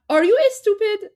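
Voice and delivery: Persian accent estupid with an e before the st, high and mock-indignant. Imitating a Persian accent to viewers, high mock question. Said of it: "Armin can probably do the accent better".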